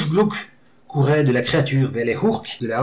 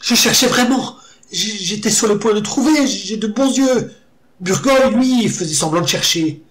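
frightened pleading; 9 semitones higher, 4 dB louder than usual. A narrator's reading. Terrified orc pleads.

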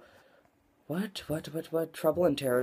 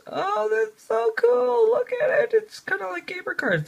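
squealing delight; 5 dB louder than usual. Real, not acted. Excited over gamer cards.

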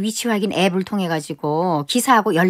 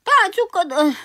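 put-on old woman dictating her funeral; mock dying wishes; 6 semitones higher than usual. Grandma gives mock last wishes. (유언하듯 울먹이는 할머니)

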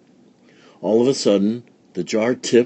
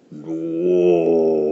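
ox bellow; 3 semitones lower than usual. A narrator's reading. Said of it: "it bellowed".

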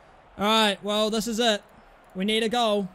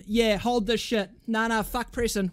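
ordinary delivery

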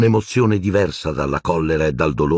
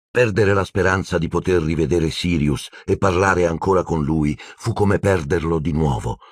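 ordinary delivery, nothing marked

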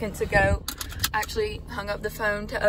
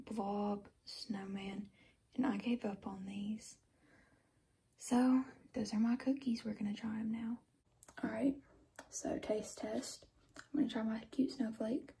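soft unvoiced whisper, keeping quiet at night; 10 dB quieter than usual. Real, not acted. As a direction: whisper so no one wakes up. Late-night whispered cookie taste test.

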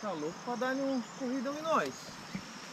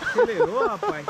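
breathless excitement; 6 dB louder than usual. Real, not acted. Heart racing after the run.

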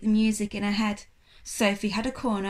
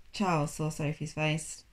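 plain reading voice

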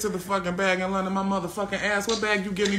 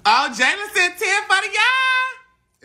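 high, loud drawn-out holler; shout-out; 13 semitones higher, 6 dB louder, slower than usual. Real, not acted. Streamer announcing to the viewers, loud shout-out. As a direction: holler it out, stretching the last word.

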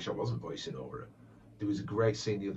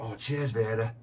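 put-on Manc accent; 4 semitones higher, 7 dB louder than usual. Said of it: "Manc accent".

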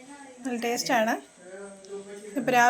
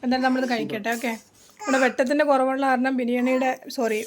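ordinary talk

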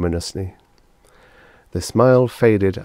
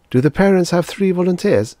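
high, louder woman's voice for a query; receptionist character; 12 semitones higher, 6 dB louder than usual. A narrator's reading. Receptionist questions doctor about volunteers, high-voiced. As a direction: pitch it well up, brisk and questioning.

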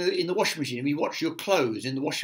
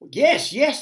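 high, loud agreement; eager chorus; 12 semitones higher, 9 dB louder than usual. A narrator's reading. Creatures agreeing to go upstairs, excited chorus. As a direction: cry out like an excited crowd. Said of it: "they chorused".

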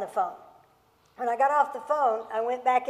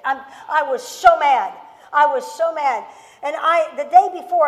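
fired-up preaching; 4 semitones higher, 8 dB louder than usual. Real, not acted.

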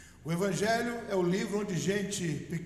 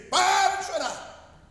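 much higher, loud and drawn-out shout; yelling; 11 semitones higher, 7 dB louder, slower than usual. Retold or imitated. Father at home yelling at crying wife and daughters. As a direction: bark it out at the whole house. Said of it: "eu dei um grito e falei".

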